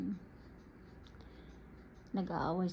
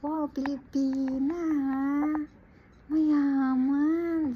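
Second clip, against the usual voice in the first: loud, high mocking sarcasm; a fellow Filipina mocking the rich employer; sarcastic wow; 7 semitones higher, 9 dB louder, much slower than usual. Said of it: "Sabi niya".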